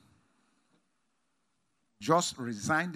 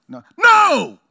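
high, loud, forceful NO; final shouted refusal; emphatic no; 13 semitones higher, 15 dB louder than usual. Shown as a demonstration. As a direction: slam the door with the no. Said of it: "do you see the difference in intonation".

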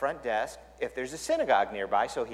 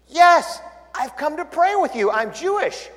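eager; 13 semitones higher, 9 dB louder than usual. Retold or imitated.

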